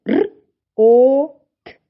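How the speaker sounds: р, о, т as three separate sounds; sounding out. Shown as a demonstration. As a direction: roll р, hold о loud, then a short т. Sounds out word rot.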